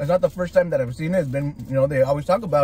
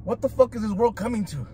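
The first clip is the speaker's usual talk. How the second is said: ordinary delivery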